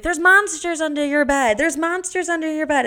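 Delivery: high, loud spooky sing-song taunt. Retold or imitated. Babysitter scaring kids about monsters, loud high spooky taunt.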